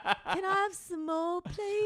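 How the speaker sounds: high, slow pleading orphan voice through laughter; Oliver. Retold or imitated. Co-host imitates orphan begging in line, high pleading. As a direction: plead in a high, hammed-up little voice. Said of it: "what Oliver was asking more for".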